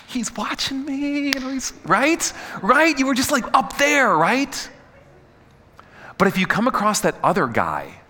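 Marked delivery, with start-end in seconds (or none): 0.1-4.7 high, giddy schoolgirl squeal; giddy gushing. Retold or imitated. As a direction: gush like a smitten teen, voice way up. Girl gushing about crush noticing.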